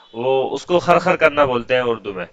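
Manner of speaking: usual speaking voice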